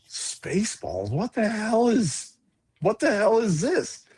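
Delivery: slow, puzzled read, then pitch swoops up; retold bafflement. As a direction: act out utter confusion, drawn out. Retells bafflement at title.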